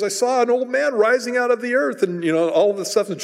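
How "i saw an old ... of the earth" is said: high frightened blurt; flipped-out witch. Retold or imitated. Witch telling Saul what she saw, frightened and high. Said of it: "she's all just flipped out".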